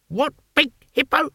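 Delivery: high muffled voice through a wall. A narrator's reading. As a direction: speak as if behind stone. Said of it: "said a muffled voice".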